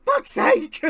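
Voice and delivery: loud, high comic exasperation; mock exasperated Cody. Streamer voicing Cody fed up with his rival, mock exasperated yell. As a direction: play it as a fed-up wrestler. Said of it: "and Cody's like".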